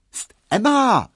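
high, loud slow hissing call; girl calling after. A narrator's reading. A girl calls after Emma across the street, high and loud. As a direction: call after her urgently. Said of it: "rief ein Mädchen hinter ihr her".

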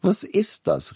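sudden high exclaim; startled cry. Acted. Tschaikowski stops himself mid-rant, startled cry. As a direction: catch yourself, startled. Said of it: "rief Tschaikowski".

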